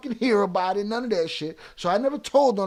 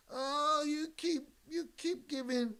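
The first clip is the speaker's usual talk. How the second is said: mocking critic; 7 semitones higher, 12 dB quieter, slower than usual. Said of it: "quoting critics".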